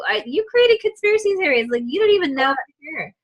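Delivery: high, far-off voice, quick at the end; mock exasperated therapist. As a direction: tease the client's silly fears in a squeaky high voice. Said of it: "I'm like".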